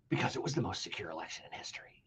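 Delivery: sarcastic hushed whisper; mock whisper. Host mocking the secure election claim, whispered sarcastically. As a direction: breathe the official line like a secret.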